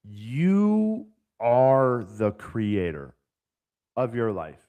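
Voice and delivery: drawn out, every word weighted; preacher-slow key message; very slow emphatic. Real, not acted. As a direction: lay it down slowly so it sinks in. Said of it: "this is what I want people to get".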